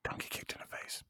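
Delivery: breathy whispered echo. Acted. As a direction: whisper it back.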